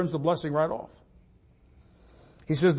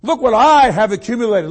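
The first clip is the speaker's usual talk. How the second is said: loud, higher self-congratulation; acted boasting; 6 semitones higher, 10 dB louder than usual. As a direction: crow proudly, mocking a boaster. Voicing a self-satisfied boaster.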